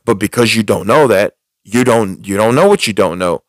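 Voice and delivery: ordinary talk, nothing marked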